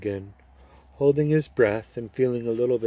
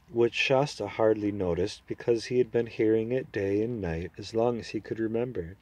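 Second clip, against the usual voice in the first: ordinary delivery, nothing marked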